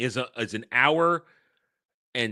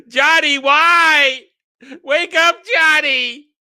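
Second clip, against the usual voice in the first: much higher, loud hammed-up wail; mock dramatic shout; 12 dB louder, much slower than usual. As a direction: overact a desperate plea. Quoting dramatic movie lines.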